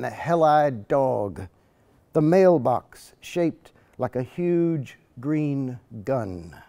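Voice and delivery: lyrical poetry reading with rising momentum; poem reading. Acted. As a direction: give each image its weight, like a reading. Reads second poem aloud.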